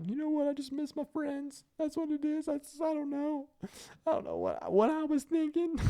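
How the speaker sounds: put-on high, small voice of a broken-down kid; broken kid, tearful. Kid broken down at boot camp, high and tearful. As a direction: mimic a tough kid finally cracking. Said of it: "he's just like".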